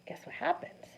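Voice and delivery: hushed stage whisper, leaning in; teasing whisper. Acted. Teacher whispering a guessing game to the class. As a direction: whisper it like a secret.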